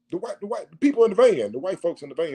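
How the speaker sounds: normal talking voice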